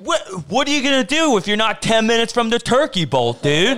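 high, loud, incredulous hollering; hammed-up shouted disbelief; mock outraged yelling. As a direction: yell it like an appalled hometown buddy. Acting out appalled hometown friends.

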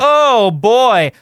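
lightly voiced princess waking up; a mock-sweet princess sigh; a touch of a cartoon princess; Disney princess. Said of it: "Like I'm a Disney princess waking up".

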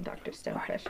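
flustered hush. Acted. Flustered NPC dropping voice.

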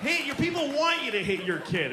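usual speaking voice

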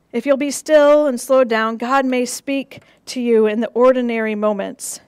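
ordinary delivery

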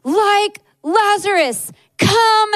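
loud, high, slow crying shout; shouted at the top of his lungs. Retold or imitated. Husband shouting a declaration over his weeping wife, full voice. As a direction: shout it like calling Lazarus from the tomb. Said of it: "he just shouted at the top of his lungs".

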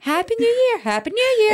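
"Happy New Year" very high and loud; imitated intonation. Mimicking every voice note, high exaggerated "Happy New Year". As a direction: repeat "Happy New Year" in a high-pitched odd tune. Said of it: "Every voice note".

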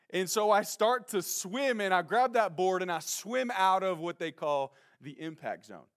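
normal talking voice

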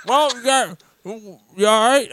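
put-on high, loud, slow drawn-out old worker voice; toothless maintenance guy. Garage worker asking a stranded driver, high and slow. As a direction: play the gummy old maintenance man checking on him. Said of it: "this maintenance guy with no teeth".

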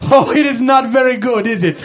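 a hint of a Dracula accent; bad vampire-ish accent. Fan greets Mister Guerrero, light put-on accent. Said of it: "sounds like you're a vampire".

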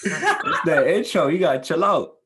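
high, loud laughing tease; teasing, laughing. Real, not acted. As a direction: tell them off while cracking up. Teasing friend amid shared laughter.

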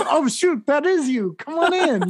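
very high-pitched mock astonishment; hammed-up excited realisation; surprised recognition. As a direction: act out a flustered, apologetic welcome. Acted bouncer suddenly recognising her.

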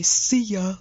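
mock dumb-guy catchphrase. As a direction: put on a silly dumb voice. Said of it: "like, in this really dumb voice".

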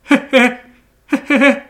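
high mocking titter; mock giggle. Podcaster mocks May Day partygoers with a high, silly giggle.

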